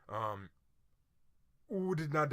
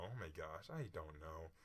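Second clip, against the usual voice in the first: muttered; 8 semitones lower, 14 dB quieter than usual. Real, not acted. Unsure on quiz.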